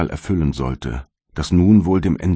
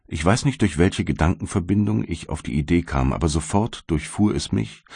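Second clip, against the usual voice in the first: plain reading voice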